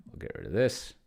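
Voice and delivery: normal talking voice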